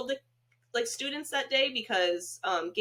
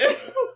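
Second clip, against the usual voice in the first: high burst of laughter; 5 dB louder than usual. Real, not acted. Laughs at absurd comparison.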